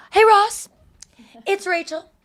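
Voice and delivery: put-on high, drawn-out drunken phone greeting; drunk Rachel. Drunk woman phoning her ex from a date, imitated tipsy and high. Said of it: "she gets all drunk".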